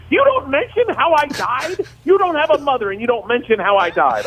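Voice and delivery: very high, outraged mother imitation; guilt-trip your child in a shrill mom voice; mock indignant mom.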